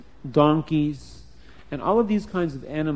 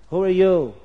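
challenging call; 3 semitones higher, 6 dB louder than usual. Acted. Generals challenge Esav.

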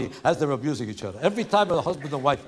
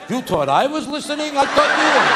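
bickering couple; 8 semitones higher than usual. Acted. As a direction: yell it like spouses blaming each other. Husband and wife quarrel over directions.

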